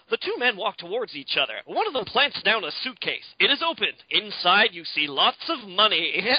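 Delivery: hammed-up chipper narrator reading the scene; cheery narrator. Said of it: "cheery narrator is like".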